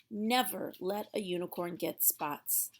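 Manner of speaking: ordinary delivery, nothing marked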